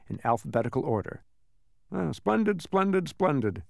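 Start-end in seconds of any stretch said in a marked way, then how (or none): 1.9-3.6 pleased, higher clerk murmuring; muttered to himself. Acted. Census taker muttering happily over his ledger, pleased. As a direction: savour each word to yourself. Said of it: "he muttered to himself".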